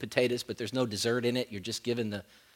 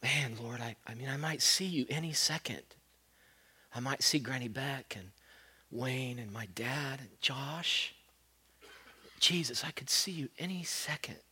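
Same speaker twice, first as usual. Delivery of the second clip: speaking to God, near death; quiet awe-filled prayer; hushed prayer; 10 dB quieter than usual. Retold or imitated.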